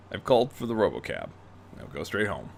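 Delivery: put-on low, soft stern old teacher; low quiet old man's rebuke; chiding. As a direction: chide him quietly from deep down. Bruce sends Terry home.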